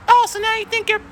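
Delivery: high, put-on squeaky comeback; mock partner whining back; mock shrill retort. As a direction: push the voice way up, mocking. Reenacted quarrel escalates.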